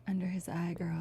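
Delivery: hushed Handmaid's Tale whisper; mock-ominous whispered line; whispered quote. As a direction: whisper it like a solemn Handmaid greeting. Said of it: "And Kelsey's like".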